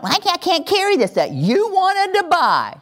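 put-on high whining child inside a parent's rant; whiny child. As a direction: mimic the whining kid. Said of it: "having me going".

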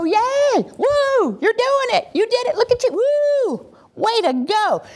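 very high, whooping cheerleader squeal; excited cheering. Acted. Speaker celebrating each little baby step, high excited cheering.

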